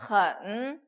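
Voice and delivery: loud 헌 with a stretched, dipping tone; third tone demo. Shown as a demonstration. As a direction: model 헌 slowly in Mandarin third tone. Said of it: "삼성이 들어왔어요".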